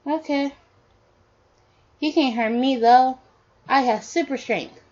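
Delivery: put-on high-pitched little boy; child's voice. Son claiming super strength to parent, in a high child's voice. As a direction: pitch it up like a small kid.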